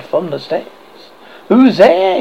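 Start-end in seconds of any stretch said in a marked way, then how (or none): 1.5-2.2 a loud, higher challenge; loud cry. A narrator's reading. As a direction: call out loud and high into the dark. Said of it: "he cried loudly".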